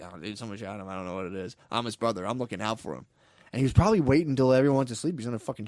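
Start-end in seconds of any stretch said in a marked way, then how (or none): 0.0-3.1 soft, quiet fake-brother lie; hushed mock innocent. Retelling the killer's lie in the hospital, hushed. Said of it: "just being like".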